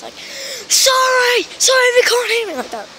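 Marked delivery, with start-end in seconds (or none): 0.7-2.5 high loud shout demo. Shown as a demonstration. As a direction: shout it at the top of your voice. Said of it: "I had to shout at the top of the voice".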